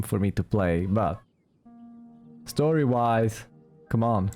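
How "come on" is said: ordinary talk, nothing marked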